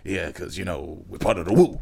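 low, hushed wise-master voice; old wise man. Retold or imitated. Imitating [name]'s voice for friends, old wise man. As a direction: speak low like a Chinese-movie sage. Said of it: "That's how he's speaking, like an old wise man from a Chinese movie".

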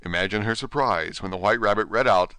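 usual speaking voice

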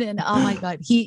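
ordinary talk

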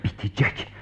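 breathy soft whisper to calm him; whispered reassurance. Acted. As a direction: hush him gently, barely voiced. Soothing friend, whispered reassurance. (fısıltıyla teskin eden)

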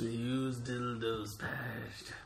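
drawn out slowly like a ghost tale; slow mock spooky drawl. Joking back at the friend, a slow mock spooky drawl. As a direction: stretch each word, mock eerie.

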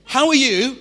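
bright, loud, rising question; cheery greeting. Shown as a demonstration. Pastor greeting the congregation, loud and bright. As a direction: greet them like you mean it. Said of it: "Do you say that sometimes?".

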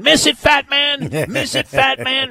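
high, loud, drawn-out heckler's yell; screamed heckle. Retold or imitated. Golf crowd heckler jeering at Monty mid-backswing, screaming. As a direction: scream it like a fan in the crowd, stretching the words. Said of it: "was screaming".

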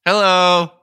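lips held still, jaw tight. Shown as a demonstration. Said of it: "let me try again".

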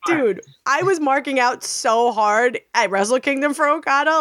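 usual speaking voice

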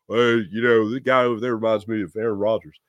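low-pitched coach impression; Mike McCarthy. Host mimicking a coach in the locker room, low impression. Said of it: "that's my dead-on Mike McCarthy impression".

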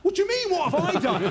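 defending himself to the coach, mock bewildered; mock indignant surprise. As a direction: play it wide-eyed and baffled. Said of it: "And I'm like".

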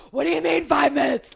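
high, incredulous outburst; retold outraged disbelief. Stressed fan reliving added injury time, high-pitched disbelief. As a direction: reenact losing it in disbelief. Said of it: "I about lost my".

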